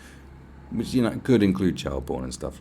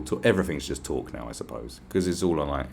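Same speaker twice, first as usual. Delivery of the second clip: ordinary delivery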